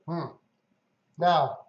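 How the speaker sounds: talking through a mouthful of candy; chewing while speaking slowly; mouth full. Real, not acted. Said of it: "sorry, I'm eating an M and M here".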